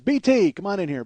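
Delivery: loud, high call across the room. Retold or imitated. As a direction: shout his name into the next room. Headliner calls BT in.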